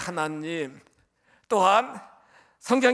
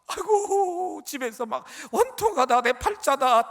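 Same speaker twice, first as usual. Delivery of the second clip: mock lament; 6 semitones higher than usual. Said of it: "탄식하는 게 아니고".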